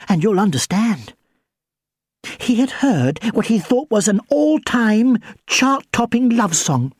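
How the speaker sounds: ordinary delivery, nothing marked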